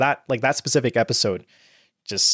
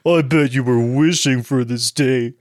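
lightly posh smug cadet; Jean Kirstein voice; 2 semitones higher than usual. Retold or imitated. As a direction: gloat over the rampage smugly. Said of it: "I use my Gene Kirstein voice".

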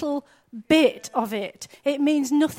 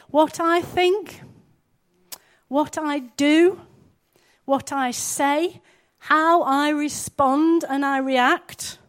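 slow emphatic list; 2 semitones higher, slower than usual. Acted. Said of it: "slow emphatic prayer list".

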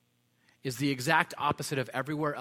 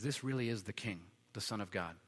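much softer and lower, hushed recognition; awed centurion; 5 semitones lower, 9 dB quieter than usual. Retold or imitated. Centurion at the cross recognizing the king, hushed. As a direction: breathe it out in awe.

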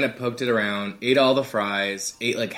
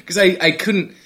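ordinary talk, nothing marked

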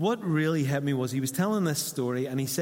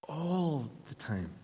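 slow, lifted repeat; 2 semitones higher, 4 dB quieter, much slower than usual. Real, not acted. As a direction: repeat "All the time" slower and tender.

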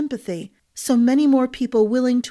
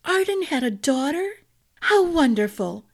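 higher, bright and delighted; 5 semitones higher than usual. A narrator's reading. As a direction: gush with joy. Wife delighted at news.

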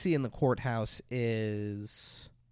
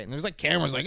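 ordinary talk, nothing marked

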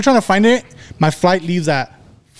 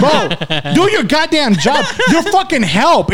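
mock outrage; 9 semitones higher, slower than usual. Retelling yelling at rude airport staff.

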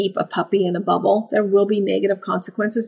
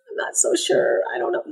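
small, high, softer unsure voice; hesitant puppy; 8 semitones higher, 5 dB quieter, faster than usual. Acted. Puppy unsure on first trip home, hesitant. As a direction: voice a pup holding back, high and quiet.

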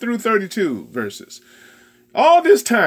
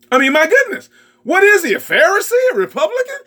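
mock disbelief; 9 semitones higher, much faster than usual. Real, not acted. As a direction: feign shock to get a laugh. Preacher's mock incredulous jab.

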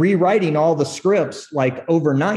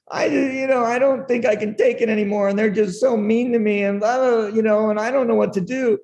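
mock whiny crying in a much higher voice; imitating crying; 7 semitones higher than usual. Young writer crying to his boss, mock whine. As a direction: whimper it like a hurt kid, hammed-up. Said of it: "and I'm crying, going".

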